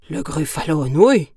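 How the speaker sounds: loud gruffalo shout of horror; big beast crying out in alarm. Acted. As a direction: bellow the words back in shock. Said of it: "cria le gruffalo".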